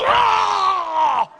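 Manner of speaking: a short shouted ah like a frenzied worshipper; hammed-up frenzied yell; mock war cry. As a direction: belt out a wild cry. Guys going crazy at altar call.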